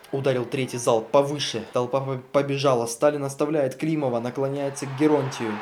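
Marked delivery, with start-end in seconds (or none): none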